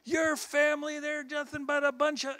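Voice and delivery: very high-pitched mock tirade; high, accusing imitation; retold insult. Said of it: "he starts insulting my family".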